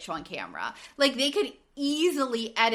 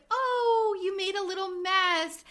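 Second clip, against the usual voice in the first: mock sugary mom voice, higher; fake sweet mom; 9 semitones higher than usual. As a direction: lightly imitate a TV mom's sweetness. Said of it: "they're like".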